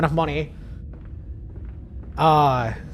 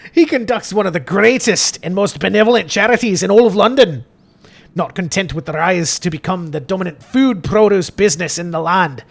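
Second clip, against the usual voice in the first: put-on declaimed, wobbly Scottish accent; Father Jeremiah. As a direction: read grandly as a Scottish priest. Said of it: "Father Jeremiah's gotta be Scottish".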